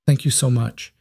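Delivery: breathed. A narrator's reading. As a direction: breathe the thanks out with relief. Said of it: "Eliza breathed".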